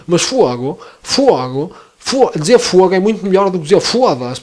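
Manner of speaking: hammed-up northern Porto accent for laughs; exaggerated Porto accent. Shown as a demonstration. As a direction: lay the Porto accent on thick. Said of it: "a exagerar imenso". (sotaque do Porto muito exagerado)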